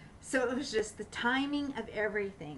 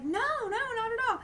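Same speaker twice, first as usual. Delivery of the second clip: much higher, imitating a cheerful friend; happy reassurance; 14 semitones higher than usual. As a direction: brush it off brightly, like the friend did. Friend's generous reply, retold.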